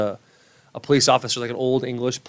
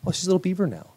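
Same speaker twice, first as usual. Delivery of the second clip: lightly higher teasing voice; 8 semitones higher, faster than usual. Retold or imitated. Husband teasing his wife about baby's teeth, light mock. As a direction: needle his wife playfully. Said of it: "be like".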